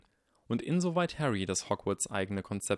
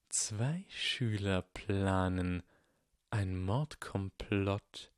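slow, dry, cold sneer; 3 dB quieter, much slower than usual. A narrator's reading. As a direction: drawl it out coldly. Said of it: "sagte eine trockene Stimme".

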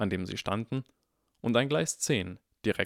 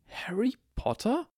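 much higher, slow amazed question; astonished; 13 semitones higher than usual. Acted. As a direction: gasp out the name. Mother recognizes him. (erstaunte Frage, viel höher)

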